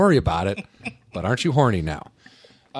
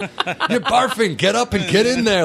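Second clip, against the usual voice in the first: high, slow, laughing mock yell; laughing party-guy voice; 8 semitones higher, slower than usual. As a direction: slur it like a party bro.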